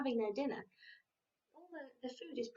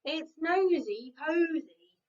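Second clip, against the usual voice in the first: cross hen; 3 semitones higher, 7 dB louder, slower than usual. Acted. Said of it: "clucked Hetty Hen crossly".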